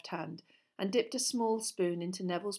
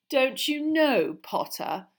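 slow, high, drawn-out taunt; breathed taunt; 7 semitones higher, 9 dB louder, slower than usual. Acted. Malfoy baits Potter with a secret, slow taunt. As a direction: draw out the question, then drop on the name. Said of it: "breathed Malfoy".

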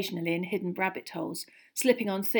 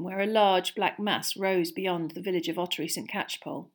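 plain reading voice

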